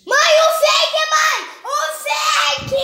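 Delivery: high shrieking call for mom; panicked scream. Real, not acted. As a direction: scream in sudden fright. Monster seen, calling out.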